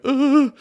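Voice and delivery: loud, high pained grunt of a man hit on the head. Retold or imitated. To listeners, acting out the man hit with a lamp, a loud pained grunt. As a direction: yelp like he just got whacked. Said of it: "This guy's like".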